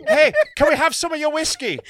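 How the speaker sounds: high, loud Irish traveller request; excitable mock Irish voice; Irish traveller accent. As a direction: call out cheekily in a broad Irish accent. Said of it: "they go in and they go".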